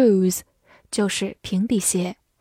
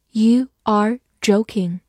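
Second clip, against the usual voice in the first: slow, separated words, uncontracted; slow reading; 3 dB louder, slower than usual. Shown as a demonstration. Teacher models the sentence for learners, slow reading. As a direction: read it word by word for learners.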